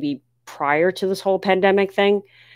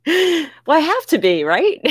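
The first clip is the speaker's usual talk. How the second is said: 1 bright laughing reply; 10 semitones higher than usual. Real, not acted. Viewer says always happy.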